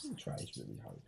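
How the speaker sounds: host's whispered aside about his crew. Acted. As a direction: murmur it off mic about Mark.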